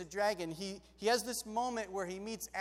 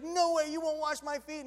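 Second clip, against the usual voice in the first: higher, louder mock refusal; protesting Peter; 7 semitones higher, 6 dB louder than usual. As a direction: refuse outright, playfully overacted. Peter refuses Jesus washing his feet.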